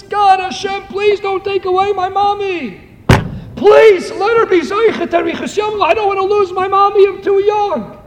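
put-on high, pleading plea of a frightened little girl; lightly voiced child begging God, a touch tearful; child's tearful prayer. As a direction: beg as a five-year-old afraid to lose her mommy. Little girl praying for dying mother.

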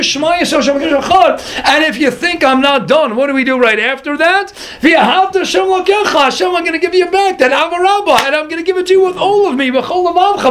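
high, impassioned sermon voice carried at full tilt; fervent preaching. Acted. Rabbi stirring his listeners about the kiss, fervent preaching. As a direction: preach it with rising fire.

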